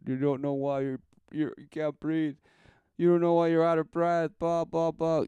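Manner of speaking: high, loud mocking gasp. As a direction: ham up the panic. Mocking choking victim.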